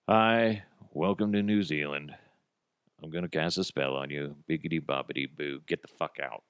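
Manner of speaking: low, quiet, bored wizard act; listless wizard greeting, sinking away; half-assed wizard. Acted. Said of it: "what if you were a half-assed wizard?".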